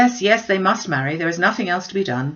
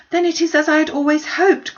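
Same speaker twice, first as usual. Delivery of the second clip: much higher and louder, hopeful burst; eager cry; 10 semitones higher, 7 dB louder than usual. A narrator's reading. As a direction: blurt out her hope with joy. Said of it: "cried Jane".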